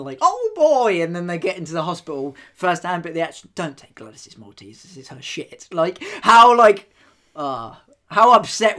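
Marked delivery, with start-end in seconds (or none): 0.2-1.0 high, loud gleeful cheer; excited. Retold or imitated. New nurse excited. 3.5-5.7 hushed warning. Retold or imitated. Staff warning new nurses.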